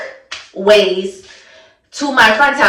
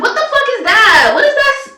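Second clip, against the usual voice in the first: much higher-pitched, incredulous outburst; outraged disbelief; 11 semitones higher, faster than usual. Retold or imitated. Storyteller replaying her reaction to fingers shoved in her nose, high-pitched outrage. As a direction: act out a high, appalled demand.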